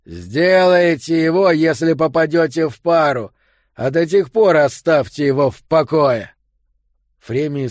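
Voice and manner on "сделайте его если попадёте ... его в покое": loud angry order; shouting. A narrator's reading. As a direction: keep yelling across the corridor. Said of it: "крикнул Йо".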